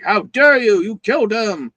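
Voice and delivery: loud, high accusing cry; hammed-up gasp of blame; mock outrage. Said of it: "everyone's all like".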